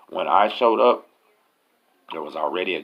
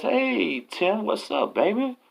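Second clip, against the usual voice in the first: put-on high, drawn-out hey to an old friend; imitated happy reunion call; retold delighted greeting; much slower than usual. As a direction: greet a long-lost buddy, pitched way up. Old friend greets him in cell.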